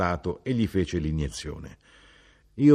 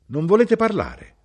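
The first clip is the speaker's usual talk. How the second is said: coaxing scold; 12 semitones higher, 7 dB louder than usual. A narrator's reading. Mother scolds the silent man.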